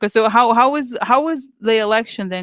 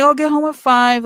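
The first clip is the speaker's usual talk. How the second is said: higher, louder sarcastic echo; sarcastic higher mock quote; mocking boss; 6 semitones higher, 4 dB louder than usual. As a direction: throw her line back at her with scorn.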